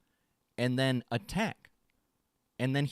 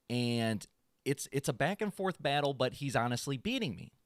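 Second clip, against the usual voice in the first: ordinary talk, nothing marked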